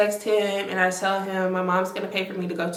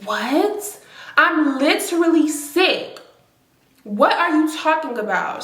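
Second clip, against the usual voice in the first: higher and louder, incredulous protest; indignant outburst; 8 semitones higher, 4 dB louder than usual. Retold or imitated. Sick girlfriend snapping back at his mom, high indignant outburst. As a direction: fire back in disbelief at the accusation.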